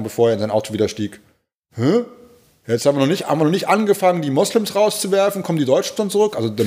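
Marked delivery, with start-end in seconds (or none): 1.7-6.3 raised pitch, indignant retort as a sneering driver; higher sneering imitation of an angry motorist; mocking the driver. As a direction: throw the line back like the rude driver did. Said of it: "Darauf reagiert er nur sozusagen".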